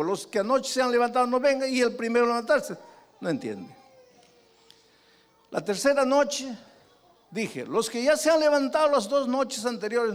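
loud, high, driving sermon voice; fiery preaching. Acted. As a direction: preach it hard to the crowd. Preacher at full force. (predicando con fuerza)

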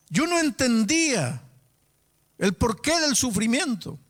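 high, forceful, ringing declaration; impassioned preaching. Retold or imitated. Doctor telling his pastor what was killing him, impassioned. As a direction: proclaim the realisation at a high pitch.

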